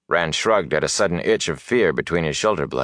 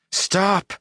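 sharp, urgent high cry held in; high, strangled call at contained volume; strangled shout. A narrator's reading. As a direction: shout it urgently without letting it carry. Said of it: "It was a strangled shout from Moiraine, sharp and urgent, but pitched not to carry far".

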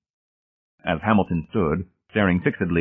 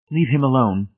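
higher, chastising wife; mild scolding in a raised pitch; 6 semitones higher, 3 dB louder than usual. A narrator's reading. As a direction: scold your husband, pitch lifted. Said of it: "she chastised her husband".

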